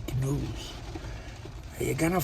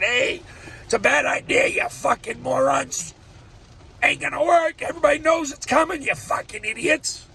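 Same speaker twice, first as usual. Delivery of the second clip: high, loud mock yell at the morons; half-acted angry yell, voice up high; retold yelling; 11 semitones higher, 5 dB louder than usual. Angry man yelling at his fellow troops. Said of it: "Generals don't like you yelling".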